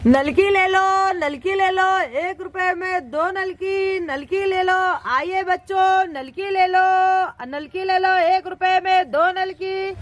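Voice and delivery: sing-song hawker's cry; repetitive, stretched-out seller's chant; street vendor's call. Acted. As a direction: call out wares to passing children, drawing out the ends.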